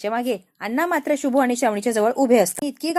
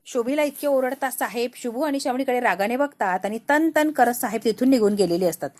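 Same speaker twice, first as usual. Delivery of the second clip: ordinary delivery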